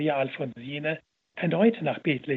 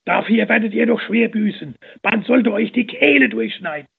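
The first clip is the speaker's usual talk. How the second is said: high, loud furious tirade; angry crowd cursing the nuns; 6 semitones higher, 8 dB louder than usual. A narrator's reading. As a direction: spit curses at the nuns. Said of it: "die Nonnen zu verfluchen".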